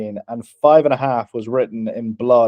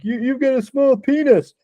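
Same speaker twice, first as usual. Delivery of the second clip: high, mocking heckler's jeer; mock heckler yell; 12 semitones higher than usual. Heckler in the crowd taunts the dying president, high mocking jeer.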